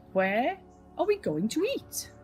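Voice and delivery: usual speaking voice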